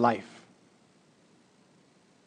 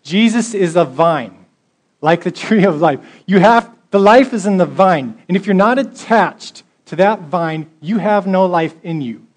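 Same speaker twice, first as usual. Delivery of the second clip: forceful preaching; 4 semitones higher, 8 dB louder than usual. Acted. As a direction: warn them with full force.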